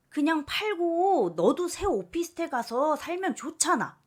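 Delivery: mother badgering her daughter to sell; loud pressuring rant; pushy mother.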